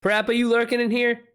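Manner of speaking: high, playful call into chat. Real, not acted. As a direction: sing out to a lurker. Calling out to a chatter.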